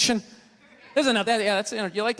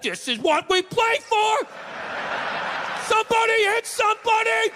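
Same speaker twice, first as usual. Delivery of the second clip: pumped-up football player; 12 semitones higher than usual. Pretend. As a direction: roar like a player hyping the team.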